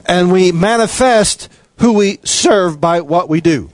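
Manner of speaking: normal talking voice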